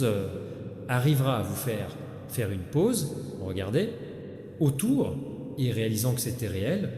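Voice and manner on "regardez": ordinary delivery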